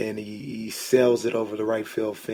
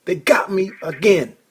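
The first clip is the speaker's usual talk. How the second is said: higher, exasperated mock outburst; retold snapping; 8 semitones higher, 4 dB louder than usual. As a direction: replay the snap, pitched up in disgust. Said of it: "I snapped".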